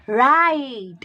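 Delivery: "Right" with the "ai" stretched long; slow rise and fall through "Right"; long I sound. Shown as a demonstration. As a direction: draw out the vowel in "Right" for the class. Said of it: "The long sound of I is there".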